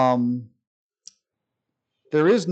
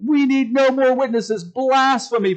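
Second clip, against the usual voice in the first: outraged accusation; 9 semitones higher than usual. Retold or imitated. High priest condemns Jesus' claim.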